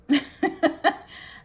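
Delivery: high, loud burst of laughter; laughing. Real, not acted. Amused by the card.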